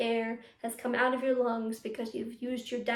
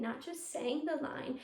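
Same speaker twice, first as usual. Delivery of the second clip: normal talking voice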